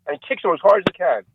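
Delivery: usual speaking voice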